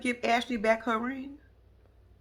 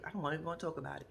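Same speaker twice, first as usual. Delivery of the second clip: rushed dismissal; 4 semitones lower, 10 dB quieter, much faster than usual. Retold or imitated. Mama shutting down talk.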